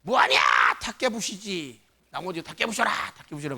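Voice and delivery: imitated dialect tirade, shouted opening that subsides; mock a mother's harsh telling-off; scolding Jeolla mother. Jeolla mother cursing at her child over breakage, mock scolding. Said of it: "전라도 말로 자식한테 막 좀 살벌한 욕을 하잖아요".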